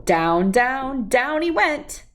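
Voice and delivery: ordinary delivery, nothing marked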